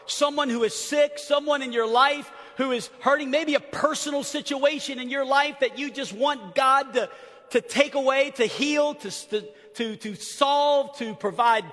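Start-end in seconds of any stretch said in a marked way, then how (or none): none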